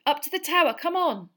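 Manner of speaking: high, loud command; urging call. Acted. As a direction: spur the beast on. Urging the hippogriff.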